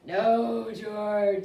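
loud, long drawn-out no; exasperated mother. Acted. Mother scolding George after he quacks, loud exasperation. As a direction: wail the scolding in mock despair.

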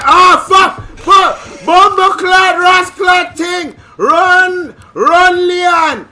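panicked, very high Jamaican shouting; scared Jamaican accent. Real, not acted. Gamer screaming at Leon to run, scared Jamaican accent. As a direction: scream in patois as fear takes over. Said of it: "when I get scared I turn Jamaican".

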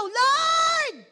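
high, loud desperate shout; shouted cry. Retold or imitated. Blind men calling to passing Jesus, loud desperate shout. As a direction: yell it out as if your life depends on it.

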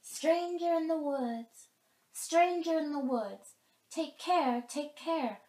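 high, loud bird call from the treetops; blue jays calling. Acted. Blue jays calling a warning from the tall oaks, high and loud. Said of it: "the blue jays called".

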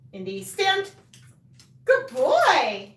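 high-pitched praise for the dog; sing-song praise to a good dog; dog command and praise. Real, not acted.

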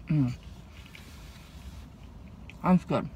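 chewing while speaking; talking through food; mouth full. Real, not acted. Seen 3: takes bite at 2.0; cheeks full, chewing through "Mm", "That's good.

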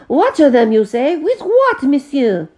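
high, loud exasperated French protest; indignant French. A narrator's reading. The Frenchman protests to the butler, high and exasperated. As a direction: demand to know with what.

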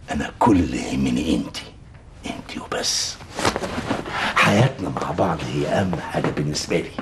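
low, intimate, unhurried voice; tender reassurance. Acted. Husband comforting wife over childlessness, tender. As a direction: reassure her gently, voice dropped low.

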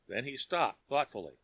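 ordinary delivery, nothing marked

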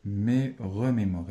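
je me remémore spoken slowly and deliberately; slow model phrase. Shown as a demonstration. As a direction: read je me remémore slowly for the class. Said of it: "راه كيقول واحد الكلمة".

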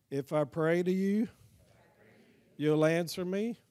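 short phrases with long pauses for the congregation; repeat-after-me prayer. Shown as a demonstration. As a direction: lead the room through a prayer, phrase by phrase.

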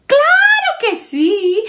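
very high, loud cheerful yes; excited chorus. Acted. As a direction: shout it with delight. Los amigos aceptan. (sí entusiasta, muy agudo)

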